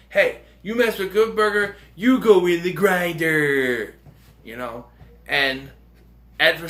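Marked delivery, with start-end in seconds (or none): 0.1-4.0 higher, slow mock-threatening warning; mock menacing threat. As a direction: drag out the grinder line like a cheesy threat. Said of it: "and they go".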